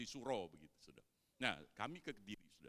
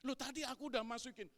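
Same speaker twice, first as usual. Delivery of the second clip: loud, high, fast protest; husband arguing, voice climbing; rising temper; 12 semitones higher, 5 dB louder, faster than usual. Retold or imitated. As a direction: defend yourself heatedly. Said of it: "Tensi ngomongnya mulai naik".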